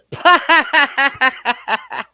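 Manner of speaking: laughing loud and long; loud laughing fit. Real, not acted. Loud laugh while mocking the troll's friend.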